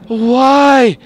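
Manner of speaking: high, loud panicked shouts; scared yelling. Real, not acted. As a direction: yell in real fright. Caught by monster, yelling.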